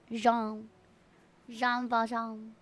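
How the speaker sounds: "Jean" and "Valjean" said the French way; French pronunciation. Shown as a demonstration. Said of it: "yes, in French".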